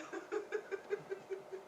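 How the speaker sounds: soft, knowing chuckle; quiet chuckle. Acted. Claims he already knows.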